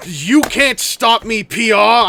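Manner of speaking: loud, slow playful yell. Acted. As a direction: shout it out triumphantly. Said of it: "why I'm yelling".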